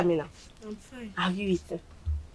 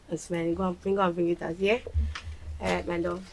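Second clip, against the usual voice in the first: normal talking voice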